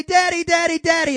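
loud, high urgent calling of Daddy; imitating a child with a skinned knee. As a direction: call out like a hurt little kid. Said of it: "They say".

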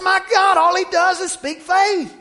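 high loud amazed outcry; mock exasperated shout. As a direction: throw up your hands and shout it. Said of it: "I said".

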